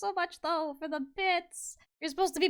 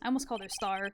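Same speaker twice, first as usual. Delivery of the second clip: normal talking voice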